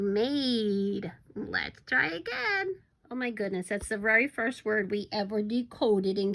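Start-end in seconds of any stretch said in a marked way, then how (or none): none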